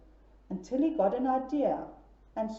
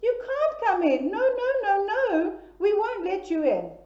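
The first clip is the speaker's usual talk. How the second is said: squealing refusal; 7 semitones higher than usual. Acted. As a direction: squeal it at the top of your voice. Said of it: "at the top of their voices".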